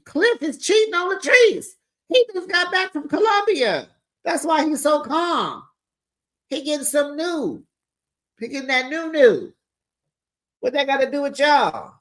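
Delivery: high-pitched gossipy outburst; excited gossip. Real, not acted. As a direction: blurt out the scandal.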